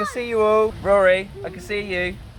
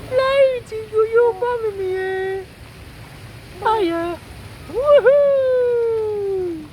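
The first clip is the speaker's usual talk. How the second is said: squeaky praising a dog; much slower than usual. Real, not acted. Cheering dog in the air.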